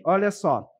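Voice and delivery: ordinary delivery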